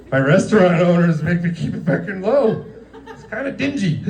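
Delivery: very high griping, laughing; mock complaining. [name] complaining to Javier Bardem about the dark room, high mock gripe. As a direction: whine about the lighting.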